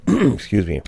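normal talking voice

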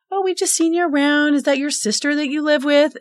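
lightly put-on, high, innocent voice; sweet innocent question. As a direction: play dumb and sweet at the neighbour. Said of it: "and said".

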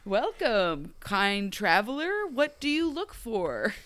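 slow, stagey innkeeper greeting; hammed-up fantasy NPC voice; mock tavern NPC. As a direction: welcome him like a video game barkeep. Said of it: "talking like an N P C".

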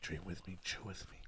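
ASMR whisper. Pretend. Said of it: "ASMR bit".

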